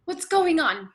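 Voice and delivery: loud, high alarmed call; alarmed shout. Acted. Daughter bursting in on her angry father, alarmed shout. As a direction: demand to know what's happening.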